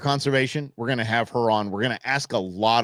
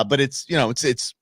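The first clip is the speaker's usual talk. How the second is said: ordinary delivery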